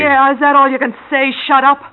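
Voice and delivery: angry mocking. Girlfriend mocking Jake's shut up, loud and scornful. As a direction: throw his words back at him.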